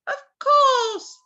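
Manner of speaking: high, loud cawing crow voice; talking crow. Acted. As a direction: answer like a squawking bird. Crow answers Gerda.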